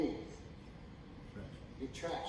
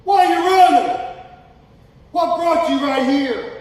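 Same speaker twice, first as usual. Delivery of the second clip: full-force preaching; 10 semitones higher, 16 dB louder than usual. Acted. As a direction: preach it at the top of your voice.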